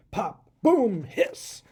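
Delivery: loud popping boom, then a hiss; machine noises. A narrator's reading. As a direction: make the machine sputter to life. Machine starts to shake.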